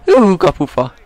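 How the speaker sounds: ú, kapufa yelled high and loud; excited shout. Real, not acted. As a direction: burst out at the post. Near miss while playing, shouting. (izgatott felkiáltás)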